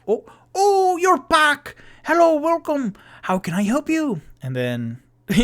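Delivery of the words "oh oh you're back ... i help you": very high, loud delighted halfling host; cheery halfling. Acted. Mister Peabody greeting returning guests at his door, very high and delighted. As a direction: squeal a bright welcome up high. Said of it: "and he goes".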